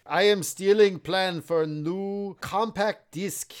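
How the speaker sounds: slow, deliberate cartoon Russian accent; mock Russian accent. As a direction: play a spy in halting Russian-accented English. Mimicking the spy's bad accent.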